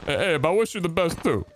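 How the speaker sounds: high-pitched joke New Yorker goodwill; mock New York accent. Gamer wishing a friend the best, mock New York accent. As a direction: wish them well in a put-on New York accent. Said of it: "as a New Yorker, I feel offended".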